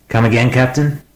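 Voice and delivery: high, startled question. A narrator's reading. Smith startled by the captain's warning on the radio. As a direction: caught off guard, pitch jumps up. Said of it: "Smith sounded startled".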